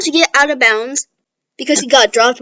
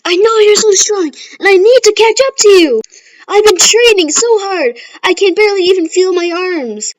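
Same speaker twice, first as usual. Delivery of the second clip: much higher, louder fired-up declaration; passionate rival speech; 8 semitones higher, 6 dB louder than usual. Retold or imitated. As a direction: pour your heart out to your rival. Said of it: "Then Bakugo".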